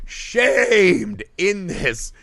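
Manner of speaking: SHAMED stretched out very long; emphatic repeat. Real, not acted. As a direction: hammer "Shamed", drawing the vowel right out.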